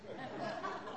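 soft laugh. Real, not acted. Laughs at own admission.

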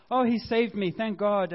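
relieved exclamation. Retold or imitated. Jonah's relief after the fish.

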